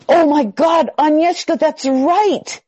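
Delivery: loud, high-pitched burst of surprise; amazed recognition. Real, not acted. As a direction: exclaim it, real astonished realization. Sudden recognition of forgotten teaching.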